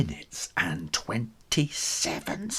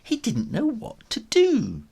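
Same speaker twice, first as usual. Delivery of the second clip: usual speaking voice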